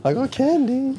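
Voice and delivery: high creepy ice cream truck voice; creepy ice cream man. Retold or imitated. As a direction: lure kids like a creepy candy man. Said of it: "sounds like those weird ice cream truck drivers".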